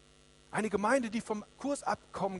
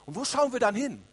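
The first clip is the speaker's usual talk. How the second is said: raised, higher preaching voice; urgent question; 4 semitones higher, 4 dB louder than usual. Real, not acted. Preacher asking the congregation where we look, urgent and raised. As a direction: put the question forcefully to everyone.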